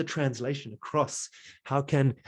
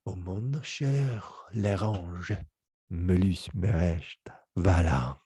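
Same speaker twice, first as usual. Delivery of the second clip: slow, charismatic French gibberish; drawn-out French-sounding nonsense; mock French; 3 semitones lower, slower than usual. Shown as a demonstration. Teacher demos charismatic French gibberish. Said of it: "that was French".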